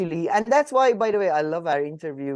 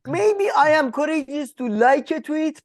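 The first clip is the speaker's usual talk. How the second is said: self-mocking; 10 semitones higher, 4 dB louder than usual. Self-mocking about own courage.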